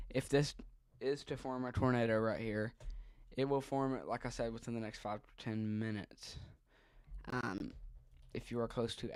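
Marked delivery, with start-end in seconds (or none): none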